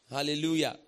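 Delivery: ordinary talk, nothing marked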